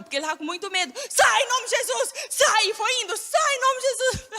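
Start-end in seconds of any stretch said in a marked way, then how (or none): 1.1-4.3 repeated high, loud shouted commands; father screaming at the possessed man, laughing through it; frightened shouting. Retold or imitated. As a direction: keep yelling it out of fear. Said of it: "continuou gritando".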